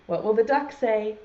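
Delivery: ordinary talk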